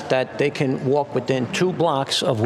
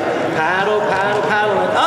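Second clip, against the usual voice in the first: shouted paddle commands, higher; coaching shout; 7 semitones higher, 3 dB louder than usual. Real, not acted. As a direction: call out the strokes over the waves. Coach calling out in the surf.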